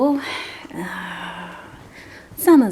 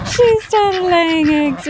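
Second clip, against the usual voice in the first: much higher and slow, beaming with pride; proud excited announcement; 10 semitones higher, 4 dB louder, slower than usual. Real, not acted. Owner telling viewers her duck laid eggs, higher, slow and proud. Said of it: "she's so proud of herself".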